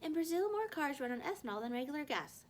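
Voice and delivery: high, loud random fact; loud announcement. A narrator's reading. Girl announces a random fact to break up the fight, high and loud. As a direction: blurt it out to stop the fight.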